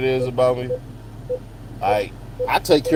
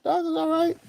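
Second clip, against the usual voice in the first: much higher mock lady answer; lightly voiced reassuring woman; lady's reply; 5 dB quieter than usual. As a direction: toss off the lady's reassurance in a high put-on voice. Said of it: "It's like".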